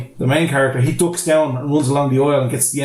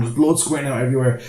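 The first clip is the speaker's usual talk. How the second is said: ordinary talk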